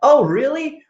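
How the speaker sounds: high, delighted mock phone chat; put-on cheery surprise, laughing between phrases; pretend phone call. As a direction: act thrilled by the caller, pitched high. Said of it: "Like you got a phone call".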